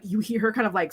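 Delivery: ordinary delivery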